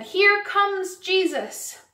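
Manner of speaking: high, slow storybook call; acted excited call from a crowd; crowd's announcement.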